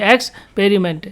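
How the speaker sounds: Experiment parted after x, short pause, then पेरिमेंट; divided into syllables. Shown as a demonstration. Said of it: "इसको कितने syllable में divide कर सकते हैं". (Experiment को हिस्सों में बोलें: "एक्स", "पेरिमेंट")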